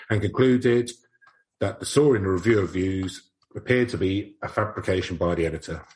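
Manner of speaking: ordinary talk, nothing marked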